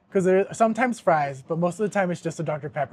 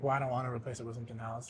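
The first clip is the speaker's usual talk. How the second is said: fast and much softer, a muttered brush-off; exasperated refusal; 7 semitones lower, 15 dB quieter, faster than usual. Retold or imitated. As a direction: rush it under your breath, fed up. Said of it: "And I'm like".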